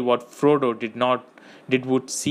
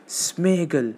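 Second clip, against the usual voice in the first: slightly firmer, higher call; a little louder; 5 semitones higher than usual. A narrator's reading. Frodo calling Smeagol once more, a little louder. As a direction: call the name out a bit more, still restrained. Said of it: "said a little louder".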